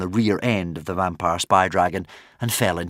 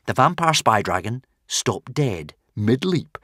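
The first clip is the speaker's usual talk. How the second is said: plain reading voice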